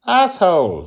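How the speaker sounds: put-on high, loud little girl voice; Joy the girl. A narrator's reading. Nine-year-old tries out a curse word, high and loud.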